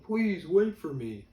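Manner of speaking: high, loud, drawn-out plea; slow hammed-up cry to be waited for; pleading big guy. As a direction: call after them, mock-pleading. Retold plea of someone left behind.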